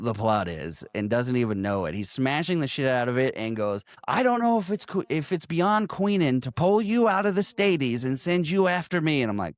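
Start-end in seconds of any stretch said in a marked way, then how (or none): 4.1-9.2 retold angry threat. Gangster to the man he is beating, retold angry threat. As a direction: imitate a man raging mid-beating, higher voice.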